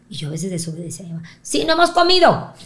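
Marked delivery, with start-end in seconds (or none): 1.4-2.5 put-on eager kid voice, much higher and louder; blurted child answer. Girl blurts to Raúl that they have not eaten, high and loud. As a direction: blurt it out before mom stops you.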